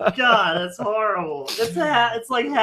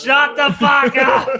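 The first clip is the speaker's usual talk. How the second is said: yelling; 6 semitones higher, 4 dB louder, slower than usual. Pretend. Voicing the driver yelling at kids.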